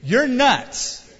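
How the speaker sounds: high, loud mocking dismissal; scoffing. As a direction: scoff at the silly news. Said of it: "these are the people with great faith now praying".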